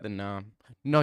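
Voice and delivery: ordinary delivery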